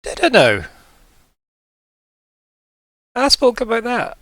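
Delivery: high put-on voice with long pauses; puzzled sloth. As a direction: puzzle it out aloud as a forgetful sloth. Forgetful sloth questions the players.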